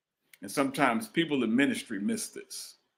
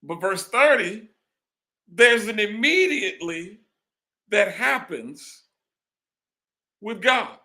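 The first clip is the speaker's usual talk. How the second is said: higher, louder preaching push; preaching at full force; 10 semitones higher, 4 dB louder than usual. Acted. Preacher building up the congregation, at full force. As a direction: lift it up and drive it home.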